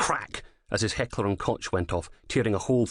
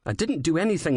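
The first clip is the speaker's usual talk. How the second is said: defensive; 9 semitones higher than usual. Acted. Defending himself.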